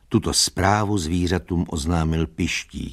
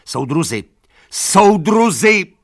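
rising to a very high, slow, loud cry; desperate shout; 13 semitones higher, 9 dB louder, slower than usual. A narrator's reading. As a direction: plead to the horses. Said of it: "křičela".

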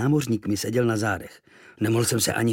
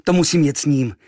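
higher, louder eager cry; mild boyish eagerness; 5 semitones higher, 8 dB louder than usual. Acted. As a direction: insist with excitement. Boy eager to join.

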